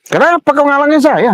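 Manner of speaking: very high, agitated question; heated, high-pitched challenge; indignant demand. Real, not acted. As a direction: demand to be let through, pitch soaring. Quarrel, demanding why he is blocked.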